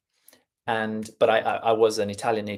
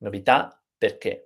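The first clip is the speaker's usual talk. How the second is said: "Novità, perché" as novi-TA, per-KE; stress on last syllables; 2 semitones lower than usual. Shown as a demonstration. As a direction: land on the final syllable of both "Novità" and "perché". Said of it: "the stress to the end".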